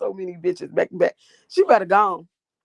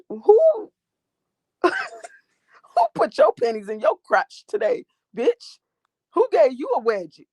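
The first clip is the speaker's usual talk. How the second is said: mocking sass; 8 semitones higher, 3 dB louder, slower than usual. Mocking a heckler in chat.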